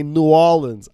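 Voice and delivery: "New Orleans" as noo-AW-linz, loud; local pronunciation. Retold or imitated. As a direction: say "New Orleans" run together like "N'awlins". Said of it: "is that how it's pronounced".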